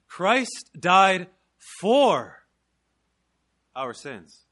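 "Christ died" and "for" loud, "our sins" dropped; stress on CHRIST DIED. Shown as a demonstration. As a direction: belt "Christ died for", then fall away. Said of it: "just emphasizing different points".

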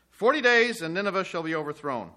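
Forty days cried out loud and high; ringing proclamation. Acted. Jonah crying his warning to Nineveh, loud. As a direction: hurl the warning at the city. Preacher repeats message, stronger.

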